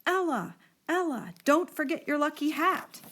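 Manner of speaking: mother calling after Ella as she pedals off. A narrator's reading. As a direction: call after a child riding away. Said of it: "her mother called after her".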